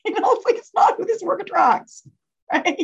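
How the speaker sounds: high-pitched amused talk through laughter; laughing. Real, not acted. Speaker joking with audience about sharks, laughing. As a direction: laugh your way into the words.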